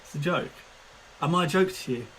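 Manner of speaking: usual speaking voice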